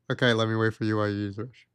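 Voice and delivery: low, very fast retold compliance; reluctant agreement. Man agreeing to wait while she uses restroom, reluctant. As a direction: rattle it off low, just going along.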